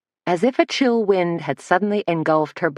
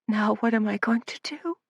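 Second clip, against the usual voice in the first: tearful, hushed despair; a higher, teary plea; sobbing; 6 semitones higher, 5 dB quieter than usual. A narrator's reading. Frightened girl sobs in Suzanne's arms, tearful. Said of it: "sobbed Lydia".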